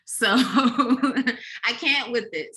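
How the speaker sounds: slow, drawn-out words broken by a laugh. Real, not acted. Laughing as she dismisses the nonsense from people she loves, slow. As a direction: laugh through it, stretching each word.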